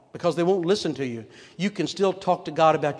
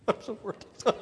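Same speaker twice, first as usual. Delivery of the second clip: mock whimper; 6 semitones higher than usual. Sarah melting down.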